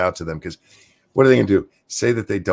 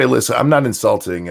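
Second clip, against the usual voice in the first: ordinary delivery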